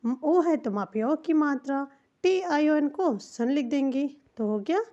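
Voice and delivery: ordinary talk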